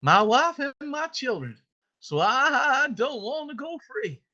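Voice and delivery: acted slave, high drawn-out Southern voice; slave's declaration. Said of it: "as the slave says".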